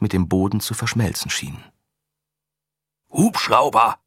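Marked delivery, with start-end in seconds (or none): 3.1-4.0 loud sharp command. Acted. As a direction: order it loudly. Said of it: "befahl Korodin".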